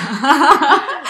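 bright rising laugh, loud; burst of laughter. Real, not acted. Laughing at her friend's book plan for May. Teasing over book choice.

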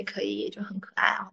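soft wistful whisper. Real, not acted. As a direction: breathe it, barely voiced. Soft wish for a sister.

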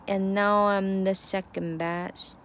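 "now" stressed, "I'm the" clipped very short; slow, hit "now" and rush "I'm the"; 重读now. Shown as a demonstration. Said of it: "I'm the的这个的非常短".

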